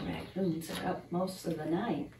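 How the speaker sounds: usual speaking voice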